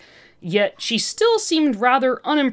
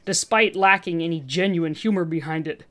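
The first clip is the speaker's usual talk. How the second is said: usual speaking voice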